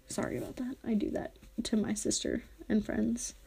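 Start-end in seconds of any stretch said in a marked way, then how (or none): 0.1-0.8 quick sheepish whisper, much softer; whispered apology. Real, not acted. Host whispering an embarrassed apology to listeners. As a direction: murmur the sorry almost to yourself.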